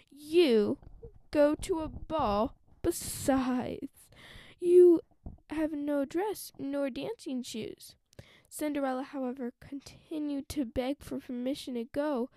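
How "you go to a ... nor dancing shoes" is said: loud, scornful stepmother; wicked stepmother. Acted. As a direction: bark it down at her. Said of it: "cried the stepmother".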